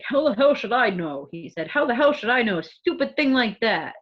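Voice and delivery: mock New York cabbie snapping back; New York accent. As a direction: bark it like a Brooklyn cab driver. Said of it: "I could just hear it in New York and the New York accent there".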